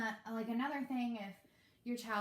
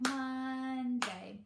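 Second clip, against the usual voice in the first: slow, split into clapped beats; clapped syllables; 3 semitones higher than usual. Shown as a demonstration. Demo for parents, clapping out syllables slowly. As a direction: say it beat by beat, slowly. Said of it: "lots of clapping".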